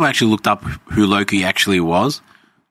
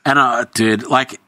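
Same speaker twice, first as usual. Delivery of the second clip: ordinary talk, nothing marked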